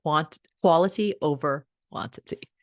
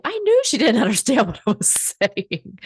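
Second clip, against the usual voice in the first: laughing grandma; 6 semitones higher, 5 dB louder than usual. Retold or imitated. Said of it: "And she's like".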